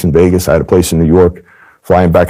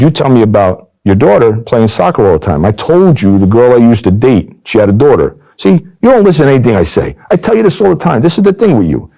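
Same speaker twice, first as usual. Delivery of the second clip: much higher, rising indignant rant; exasperated scolding; 7 semitones higher than usual. Retold or imitated. Agent turning the tables on the mobster: high indignant rant. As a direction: pile on the guilt like a nagging friend.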